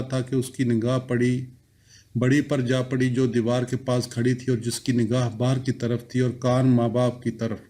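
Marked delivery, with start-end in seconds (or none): none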